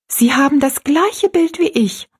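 put-on high-pitched girl striking up talk; lifted, girlish storybook voice; girl's voice. A narrator's reading. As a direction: remark it as a young girl. Narrator voices young Rosalie.

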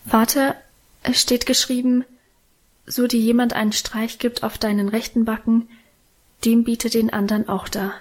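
usual speaking voice

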